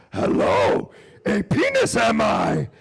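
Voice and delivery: loud, drawn-out puppet-character impression; Yoda. Friend unveils his new trick to the comic, Yoda impression. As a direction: shout it slowly in a strange character voice. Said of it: "It's called the Yoda".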